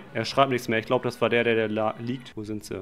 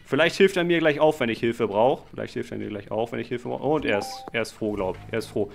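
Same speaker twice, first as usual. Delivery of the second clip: ordinary talk, nothing marked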